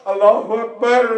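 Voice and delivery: ordinary talk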